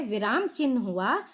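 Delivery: ordinary delivery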